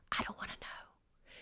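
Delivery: hushed unvoiced whisper, half-joking dread. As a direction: breathe it like a spooky secret. Adult fear of the ocean.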